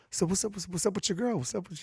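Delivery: very soft, imitating a furtive aside; sly hushed question. Friend pulls him aside about his girlfriend, hushed prying. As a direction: ask under your breath, feeling him out.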